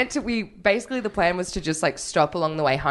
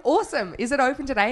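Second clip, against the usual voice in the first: chirpy self; 7 semitones higher than usual. Retold or imitated. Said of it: "And I was like".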